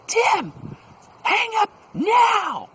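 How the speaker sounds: loud, high-pitched drawn-out shout; yelling. Retold or imitated. Two supervisors yelling at a telemarketer to end his call. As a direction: holler it across the room, stretching each word. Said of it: "come plodding out and they yell".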